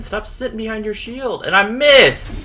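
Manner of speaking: loud, high exasperated cry; frustrated outburst. Real, not acted. Gamer cursing another missed hit, loud exasperation. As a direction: burst out in real frustration.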